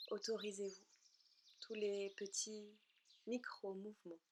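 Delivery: unhurried relaxation guidance in short, soothing phrases; guided meditation. Acted. Leads a yoga nidra relaxation.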